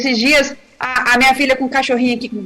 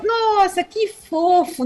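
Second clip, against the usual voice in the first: high, slow cooing over the puppy; imitating daughter gushing over a cute puppy; daughter's delight; 5 semitones higher, slower than usual. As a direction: coo like a charmed little girl. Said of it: "minha filha olhou".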